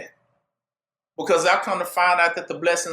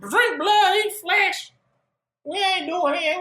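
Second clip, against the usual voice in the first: higher, mock-outraged crowd; the crowd refusing to drink blood, higher voice; 10 semitones higher, slower than usual. Higher mock-outraged crowd.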